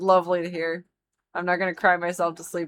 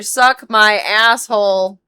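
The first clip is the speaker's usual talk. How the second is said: slow loud outburst; 2 semitones higher, 9 dB louder, slower than usual. Real, not acted. Angry outburst at game cost.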